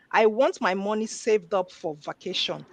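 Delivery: normal talking voice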